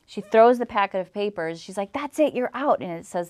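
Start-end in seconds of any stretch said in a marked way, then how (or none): 1.9-2.8 high-pitched imitation of an angry landlord; retold outburst. Landlord throws papers at breastfeeding tenant, imitated angry outburst. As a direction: mimic her ordering them out, pitch raised.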